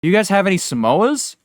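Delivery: light up at the idea of cookies; perked-up Hank. Retold or imitated. Hank hearing about Girl Scout cookies, lightly voiced perking up. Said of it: "And he goes".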